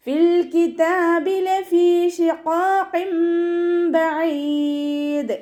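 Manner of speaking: slow, high, melodic tajweed chant; Quran recitation. Shown as a demonstration. Teacher chants the verse for students, slow and melodic. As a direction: draw each syllable out in a sung recitation.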